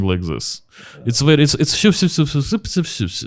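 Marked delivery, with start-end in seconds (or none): 1.8-3.3 "shup" as a high, soft sibilant patter; string "shup" together in soft hushed bursts; soft hushing sounds. Shown as a demonstration. Showing how soft real Russian sounds, "shup" in high hushing babble. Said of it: "is actually very soft".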